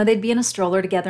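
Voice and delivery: ordinary talk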